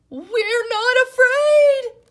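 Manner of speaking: very high, loud, slow scream. Acted. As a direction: scream the denial in fright. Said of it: "screamed Frog and Toad".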